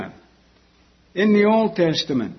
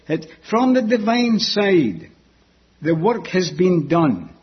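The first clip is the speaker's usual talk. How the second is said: ordinary delivery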